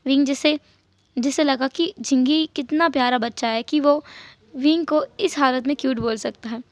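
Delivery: usual speaking voice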